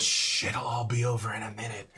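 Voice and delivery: a hint of a hushing, soothing voice; [name] soothing. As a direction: lightly voice a calming hush. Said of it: "You hear [name]'s voice".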